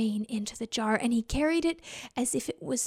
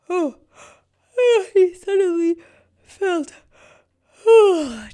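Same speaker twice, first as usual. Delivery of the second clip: yawning; 6 semitones higher, 10 dB louder, slower than usual. A narrator's reading. Sudden sleepy yawns.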